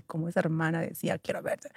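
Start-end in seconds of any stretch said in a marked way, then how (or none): 1.2-1.7 unvoiced hushed echo; whispered quote. Recalling the sister's demand, whispered. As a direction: breathe the words softly.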